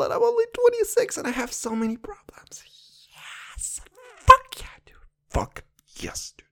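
strained, voiceless whisper breaking high; hoarse whispered venting with a burst; voice giving out. Real, not acted. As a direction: push the words through a failing voice. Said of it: "voice cords not working like at all".

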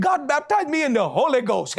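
high, exuberant retold news; excited announcement. Husband telling his wife what God did, excited.

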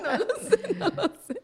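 high laughing voice through the words. Real, not acted. Laughing as the host presses about crying. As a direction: giggle while admitting you don't know. Laughing after awkward silence.

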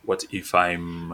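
usual speaking voice

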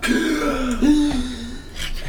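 sharp high startled gasp; dramatic gasp. Acted. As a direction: gasp like you just woke in fright. GM narrates waking to rumble.

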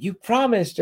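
retold scream. Demon wife screaming at the man who told, mock scream. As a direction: imitate her scream without full volume. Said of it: "like, screaming".

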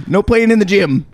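mock rule-giving voice; lightly voiced landlord. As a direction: forbid it like a petty manager, playfully. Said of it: "They're like".

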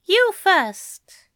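high, firm command; commanding in a high angel voice; ordering angel. A narrator's reading. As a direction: order it, pitched well up. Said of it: "the angel ordered".

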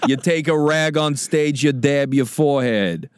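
drawn-out Brooklyn comedy coaching; Brooklyn guy. Pretend. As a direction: lecture as a Brooklyn guy, drawing it out. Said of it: "I'm a Brooklyn guy".

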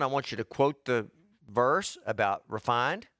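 ordinary talk

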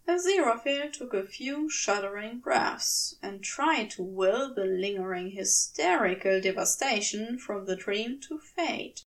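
plain reading voice

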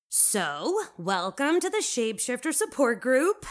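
high, loud cheery greeting; beaming welcome. A narrator's reading. Jay opens the support group reading notes, beaming. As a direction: welcome the whole group brightly, pitch lifted. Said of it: "Jay beams around the circle".

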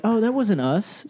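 high, slow, mock-innocent "that wasn't us"; mock innocent denial. Mimicking Russians denying the poisoning, high and mock-innocent. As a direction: play dumb in a high put-on voice. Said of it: "They were like".

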